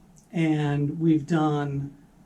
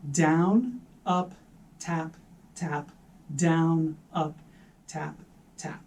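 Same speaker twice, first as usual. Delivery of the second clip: down strong, taps dropped much softer; accent on down; 4 dB quieter, slower than usual. Shown as a demonstration. Drum teacher changing the pattern we say, down accented. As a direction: punch down, let tap fall away quietly. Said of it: "for that first note, that's the accent".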